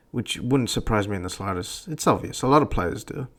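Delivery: usual speaking voice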